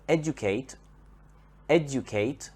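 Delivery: "educate" with the first syllable, ED, stressed. Shown as a demonstration. Teacher shows learners the verb's stress, ED stressed in "educate". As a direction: lean on the opening of "educate": ED-ucate. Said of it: "has the stress on the first syllable".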